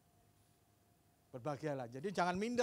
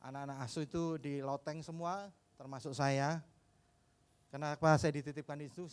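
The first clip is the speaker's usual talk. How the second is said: normal talking voice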